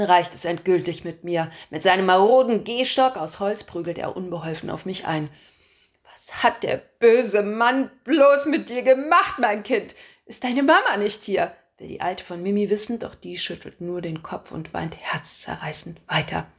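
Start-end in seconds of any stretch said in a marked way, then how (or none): 6.0-11.5 slow, higher, loud worried granny voice; fussing old woman. A narrator's reading. Old woman fussily questions the crying child. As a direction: dote on the child in dramatic concern.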